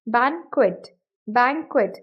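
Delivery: बैंक्वेट as BANG-kwit, with a kw sound; UK English pronunciation. Shown as a demonstration. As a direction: model बैंक्वेट twice, qu said as "kw". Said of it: "UK English में इसको as banquet pronounce".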